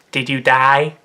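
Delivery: lightly put-on comic voice, slightly low; deadpan comic question in a character voice; Mister Chow. Asking the uncle waking in hospital, Mister Chow impression. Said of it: "Mister Chow".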